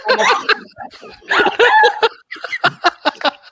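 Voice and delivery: high loud burst of laughter; big laugh. Real, not acted. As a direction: laugh hard.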